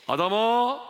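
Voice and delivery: high, loud, drawn-out summons; big loud call. Acted. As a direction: shout the name as if calling across a distance. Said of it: "큰 소리로 부르셨어요". (큰 소리로 길게 외치기)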